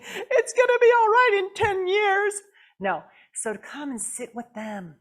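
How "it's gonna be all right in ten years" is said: high, loud crying wail; mock sobbing sad person. Sad person mocking the advice, high sobbing wail. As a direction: wail it through fake tears. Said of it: "They're not thinking".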